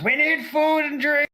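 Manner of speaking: high, loud kitchen bellow; screaming orders. Acted. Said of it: "start screaming orders".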